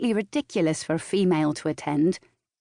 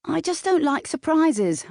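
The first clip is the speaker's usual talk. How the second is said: higher-pitched defensive protest; 10 semitones higher than usual. A narrator's reading. Caught partner excusing the birthday snooping, defensive. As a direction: pitch it up, justifying yourself. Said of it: "I said defensively".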